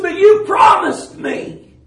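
high, loud, drawn-out objection; pleading protest. Retold or imitated. Dreamer to the lady who stops guiding him, loud pleading protest. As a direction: cry out as if let down. Retold protest to his guide.

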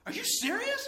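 very high squeal with a laugh; disbelief. Retold or imitated. Hearing the books were recorded sermons, laughing high disbelief.

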